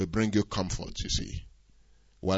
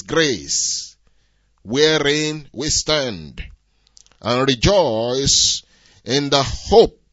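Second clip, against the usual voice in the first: slow, loud declaiming of the verse; scripture reading; 9 dB louder, slower than usual. Acted. Preacher proclaiming a verse, loud and slow. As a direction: read the Bible verse to the congregation with slow, loud weight. Reads scripture loudly and slowly.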